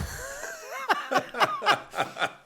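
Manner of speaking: long falling laugh; delighted high laugh. Real, not acted. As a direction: burst out laughing. Laugh at Bobby's reply.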